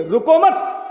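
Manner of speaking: रुको मत run together as one rising unit; मत joined to रुको. Shown as a demonstration. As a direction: carry straight through रुको into मत. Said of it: "मत को भी हम पढ़ लेंगे".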